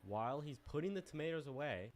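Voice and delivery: ordinary talk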